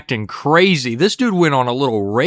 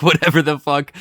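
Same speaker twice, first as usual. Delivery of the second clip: ordinary delivery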